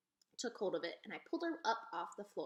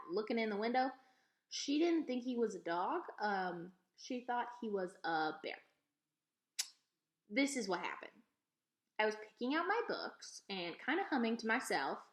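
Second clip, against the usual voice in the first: ordinary delivery, nothing marked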